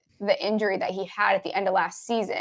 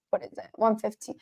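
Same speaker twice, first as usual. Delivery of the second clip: whispered aside; 7 dB quieter than usual. Real, not acted. Host checks the interception price, whispering to himself. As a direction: drop to a whisper while checking.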